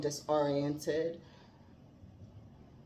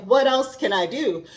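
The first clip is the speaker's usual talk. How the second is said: higher, louder, bursting with eagerness; eager question; 7 semitones higher, 8 dB louder than usual. Retold or imitated. Voicing Isabela freed at last, higher and eager. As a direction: burst out like someone newly freed. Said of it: "And she's like".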